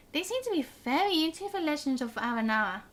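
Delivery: ordinary talk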